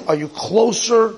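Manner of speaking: "closer" lifted high and drawn out; stress on closer. Real, not acted. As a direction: lean hard on "closer", slowly. Key question in the lesson.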